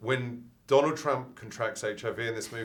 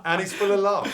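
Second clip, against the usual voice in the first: laughing; 10 semitones higher, 6 dB louder than usual. Real, not acted. Laughing about character.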